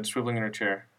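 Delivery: ordinary delivery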